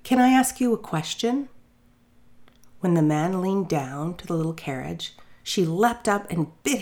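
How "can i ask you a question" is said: high, raised call for attention; a tiny mouse calling up to a fisherman. A narrator's reading. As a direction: call up to the man on the bridge. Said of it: "she called out".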